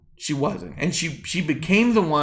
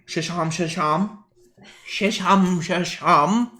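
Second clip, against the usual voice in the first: mock Irish gibberish; slower than usual. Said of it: "Worst Irish accent impression".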